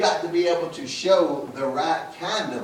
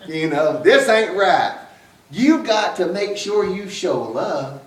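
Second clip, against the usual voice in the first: higher, louder stern grandmother; scolding grandmother; 5 semitones higher, 6 dB louder than usual. Retold or imitated. Grandmother telling the family their teasing isn't right, louder. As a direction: lay down the law as grandma. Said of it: "And she said".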